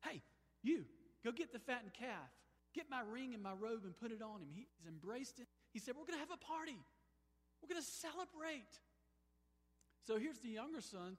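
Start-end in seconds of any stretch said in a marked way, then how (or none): 0.0-4.5 father calling out loud and high, overjoyed; excited father. Retold or imitated. Father calling servants, overjoyed and loud. 6.0-8.8 very high, bright party announcement; overjoyed father. Retold or imitated. Father announcing a party for returned son, jubilant.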